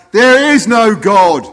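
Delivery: loud, high, drawn-out retort; yelling back. Acted. Atheist neighbour answering the old lady, defiant yell. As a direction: yell it slowly across to next door. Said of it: "atheist neighbour next door yells back".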